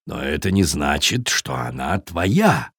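low, slowing, calm objection; objecting Viking. A narrator's reading. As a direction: reason with the raven in a low voice. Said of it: "возразил Таппи".

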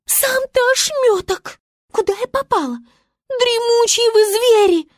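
высоко и громко, возмущённо; обиженная Звезда фыркает и кричит; фыркнула Звезда. A narrator's reading. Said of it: "фыркнула Звезда". (Звезда фыркает на зверей, высокий возмущённый голос)

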